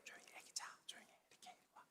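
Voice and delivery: urgent whisper to the family; hushed warning whisper. Retold or imitated. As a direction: breathe it so the baby stays asleep. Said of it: "그 가족들한테".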